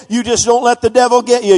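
ordinary delivery

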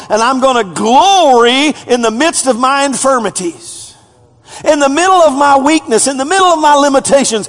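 high, loud paraphrase proclaimed; preaching at full force. Acted. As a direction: declare it boldly.

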